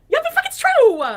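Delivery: shrieking high and loud. Real, not acted. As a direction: yell it back in protest. Answering accusation of pretentiousness.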